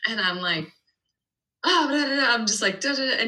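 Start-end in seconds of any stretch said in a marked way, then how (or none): 1.6-3.2 high-pitched nonsense babble imitating fast talk; mock rapid chatter. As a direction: mimic yourself rattling on. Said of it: "you're talking so fast".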